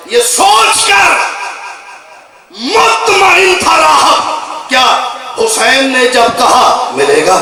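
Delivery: high, slow, drawn-out recitation; slow majlis declamation, dropping at the close; dramatic verse declamation. Acted. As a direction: stretch the verse out and cry it to the crowd. Declaims verse after plain talk.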